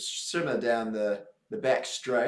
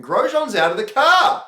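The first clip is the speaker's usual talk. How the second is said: sudden loud high outburst; rising alarm as Grosjean climbs out; excited; 13 semitones higher, 11 dB louder, slower than usual. Real, not acted. Co-host calls Grosjean leaving the car, loud and excited. Live race watch-along commentary.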